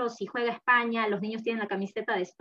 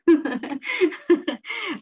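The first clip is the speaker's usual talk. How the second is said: laugh; 7 semitones higher, 6 dB louder than usual. Real, not acted. Laughs at her own story.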